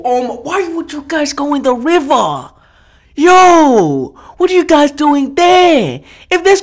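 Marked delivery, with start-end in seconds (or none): none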